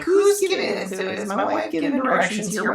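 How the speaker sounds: high-pitched, exasperated mock disbelief; mock incredulous complaint. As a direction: complain in a squeaky, put-on high voice. Mock complaint about bad directions.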